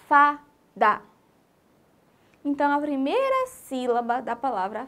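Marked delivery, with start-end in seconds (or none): 0.1-1.0 "fada" in two separate beats, fa and da; syllable by syllable. Shown as a demonstration. Teacher asking children to hear the word, syllable by syllable. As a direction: sound out "fada" clearly for listening. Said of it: "o som da palavra".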